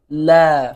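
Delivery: loud, drawn-out "لا" with a clear l; teacher's model pronunciation. Shown as a demonstration. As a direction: model a long "لا" for pupils. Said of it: "از پشت من تکرار بکنین".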